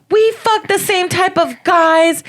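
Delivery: high, slow mocking girl voice; mocking heckler. Heckler quoted on stage, mocking high slow voice. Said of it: "And then she's like".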